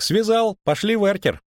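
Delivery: high-pitched, insistent bard; imperious, pitched well up; demanding bard. A narrator's reading. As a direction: order the innkeeper about in a high voice. Lyutik orders beer in story.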